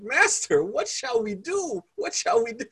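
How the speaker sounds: high, frantic cry for help; panicked servant. Retold or imitated. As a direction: call out to the master in panic. Panicked servant voiced in story.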